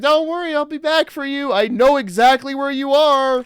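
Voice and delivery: high, loud mock reassurance; mock reassuring. Mocking the heroine reassuring the trapped man, high and loud. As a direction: promise sweetly, high and loud. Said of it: "she's like".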